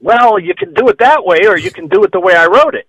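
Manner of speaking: retold tactful correction. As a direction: act out gingerly correcting your idol.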